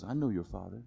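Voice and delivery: lightly voiced wise monkey.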